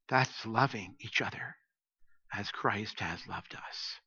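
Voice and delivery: soft, low, slowing to near silence; hushed sincerity. Real, not acted. Pastor telling his dear friends about unconditional love, hushed and sincere. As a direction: let it sink to a tender hush. Tender point closing a sermon story.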